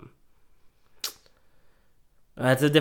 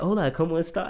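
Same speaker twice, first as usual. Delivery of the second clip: higher-pitched Spanish with a thick American accent; super American accent; 5 semitones higher than usual. Shown as a demonstration. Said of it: "super American accent".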